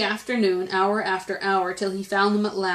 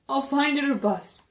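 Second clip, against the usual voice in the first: higher, determined vow; mildly raised storybook cry; 6 semitones higher than usual. A narrator's reading. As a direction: swear you will do it. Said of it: "cried Horton".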